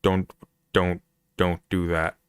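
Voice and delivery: ordinary talk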